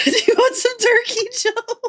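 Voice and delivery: high, giggly squeal; laughing offer. Real, not acted. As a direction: ask it while cracking up. Joking offer while cracking up.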